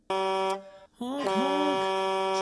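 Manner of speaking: playful horn noise; honked truck horn sounds; mock horn honk.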